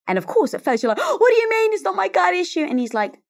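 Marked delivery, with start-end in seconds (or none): 1.2-2.6 high, loud mock-indignant disbelief; imitating her defensive self, high and loud; indignant denial. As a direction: protest like you're offended, pitched way up. Retold defensive denial to husband.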